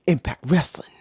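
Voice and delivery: breathy whisper, no voice; voiceless whisper of Impact Wrestling; whispered aside. Real, not acted. Whispered aside after cursing.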